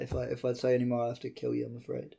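softly, mock top secret hush.

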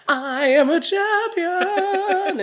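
drawn-out, high, loud declaration; grandiose, slow, ringing delivery; mock triumphant proclamation. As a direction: stretch it out like a self-crowned hero. Mock proclaiming her champion status.

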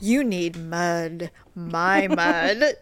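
low, slow seductive voice; mock porn officer. Joke porn scene, officer's line.

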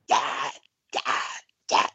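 ordinary talk, nothing marked

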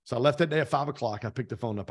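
ordinary talk, nothing marked

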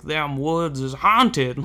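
slow mock rural drawl warning; a spooky rural local, slowed right down; backwoods local. Locals warning the filmmakers off the woods, slow mock rural drawl. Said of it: "they hear repeatedly".

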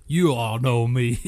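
put-on Southern bayou drawl; bayou swamp man. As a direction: play a swamp local from the bayou. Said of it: "the guy from the bayou".

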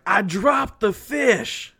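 loud, high, drawn-out confession; exasperated retold cry. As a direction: imitate an upset brother shouting bad news. Brother confessing a blunder in retelling.

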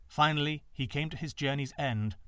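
ordinary delivery

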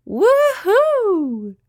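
very high, loud squealing whoop; little spider shrieking happily; Winky's whoop. A narrator's reading. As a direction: squeal with joy on the ride. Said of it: "cried Winky".

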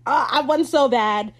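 high, loud acted outburst; mock gloating cry. Acts out villain going over to Steed.

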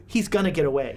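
low, calm imitation. Game master echoing a player about the fleeing man, very calmly. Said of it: "She just very calmly says".

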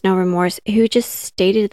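ordinary delivery

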